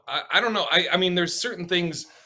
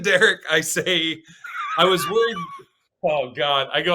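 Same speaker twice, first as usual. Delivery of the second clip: normal talking voice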